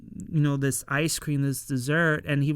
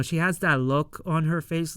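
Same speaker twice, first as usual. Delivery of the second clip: ordinary talk, nothing marked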